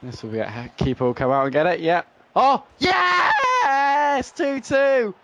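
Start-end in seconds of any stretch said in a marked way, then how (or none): 2.3-5.2 high cracking shout of joy; ecstatic goal shout. Real, not acted. Gamer screaming as his team levels, ecstatic cracking shout. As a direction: let the yes burst out and break. Gamer scores late equaliser.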